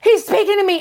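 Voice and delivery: high, loud burst of delight; excited. Retold or imitated. Speaker retells God speaking to her, excited burst. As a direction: blurt it out thrilled. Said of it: "I get so excited".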